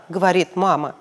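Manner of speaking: ordinary delivery, nothing marked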